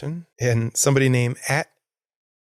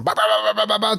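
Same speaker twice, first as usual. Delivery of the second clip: high-pitched vocalized punk riff; imitating fast riff; 9 semitones higher than usual. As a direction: rattle off the band's riff in high "ba"s. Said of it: "they'd go from".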